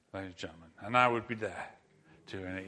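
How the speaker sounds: ordinary delivery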